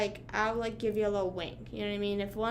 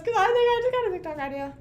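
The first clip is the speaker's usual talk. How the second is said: excited; 10 semitones higher, 4 dB louder, faster than usual. Real, not acted. Excited idea.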